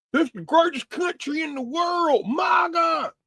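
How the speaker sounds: hammed-up, high-pitched Southern cheerleading; Byron Donald, Southern accent. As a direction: holler it in an exaggerated Southern accent. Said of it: "I shouldn't do a Southern accent for Byron Donald's".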